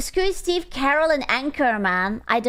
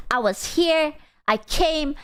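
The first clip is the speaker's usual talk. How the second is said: normal talking voice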